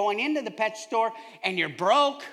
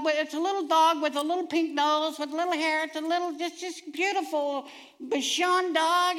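put-on gushing kid; 9 semitones higher, 3 dB louder than usual. Son pleading with dad over the pink-nosed dog, high dreamy voice. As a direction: sell the dog like a smitten boy.

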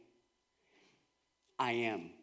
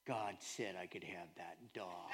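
put-on earnest kid; 13 semitones lower, 20 dB quieter than usual. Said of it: "Josiah looks at me and says".

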